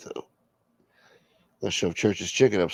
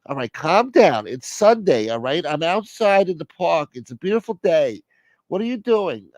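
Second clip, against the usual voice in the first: exasperated relative; 7 semitones higher than usual. Acted. Plays exasperated relative on phone.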